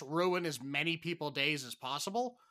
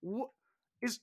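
mock confusion; 9 semitones higher, 6 dB louder than usual. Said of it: "I was sitting there just like".

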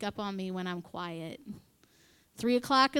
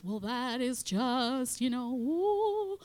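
slow, lightly put-on prim voice; mock pious tone; 3 semitones higher, much slower than usual. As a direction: parody a sanctimonious lady, slowly. I'm not one of those.

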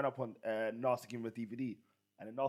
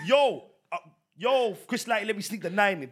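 [name] impression; 8 semitones higher, 10 dB louder than usual. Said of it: "when [name] is telling you".

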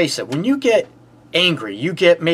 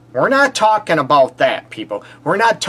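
ordinary talk

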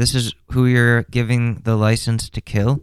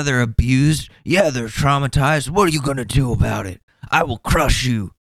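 lightly voiced, higher meathead tough guy; mock the aggressive gym bro, pitched up; roided-up juicer; 6 semitones higher than usual. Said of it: "This guy, the juicer".

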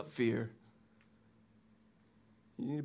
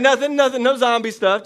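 loud, high, hurried denial; defensive kid; 8 semitones higher, 10 dB louder than usual. Retold or imitated. Son denying to his dad that he watched zombie shows, loud and high. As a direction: protest your innocence at once.

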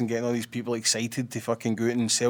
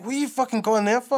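high-pitched incredulous question; retold disbelief; 10 semitones higher than usual. Reacting to friend moving there, imitated incredulity.

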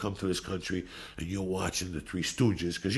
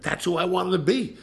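usual speaking voice

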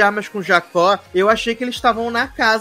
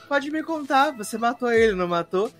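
mock secretive question; 3 semitones higher, 7 dB quieter than usual. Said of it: "ela pergunta pro Ben Affleck".

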